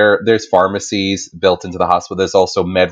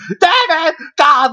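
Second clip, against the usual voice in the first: frantic nurse; much slower than usual. Retold or imitated. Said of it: "she's like".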